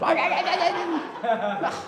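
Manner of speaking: usual speaking voice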